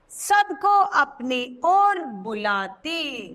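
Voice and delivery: put-on slow, sing-song reading of a children's poem; rhyme recitation. Shown as a demonstration. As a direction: chant the rhyme lively for kids.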